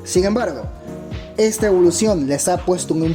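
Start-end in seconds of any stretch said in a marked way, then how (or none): none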